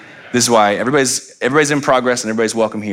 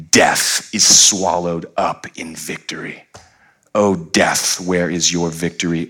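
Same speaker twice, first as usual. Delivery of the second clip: low, measured Bible reading; solemn scripture reading; 5 semitones lower than usual. Acted. Preacher reading of death's defeat to the congregation, solemn and low. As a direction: read it gravely, lingering on the final lines.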